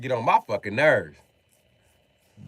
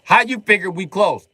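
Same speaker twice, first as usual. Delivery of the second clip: high, loud challenge in a retold argument; incredulous retort; 9 semitones higher, 5 dB louder than usual. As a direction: throw the question back, voice jumping up. Disbelieving reply in retold exchange.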